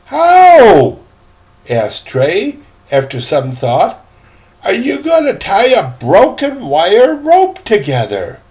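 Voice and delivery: put-on high, eager boyish question; curious boy. Young Trey asking the old seaman, high and curious. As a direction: ask it like a wide-eyed kid.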